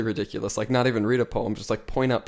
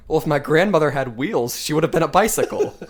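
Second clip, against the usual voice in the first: quoting Italian chef; 5 semitones higher, 7 dB louder than usual. Retold or imitated. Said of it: "He goes".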